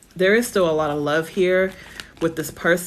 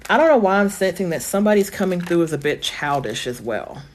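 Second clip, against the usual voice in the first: normal talking voice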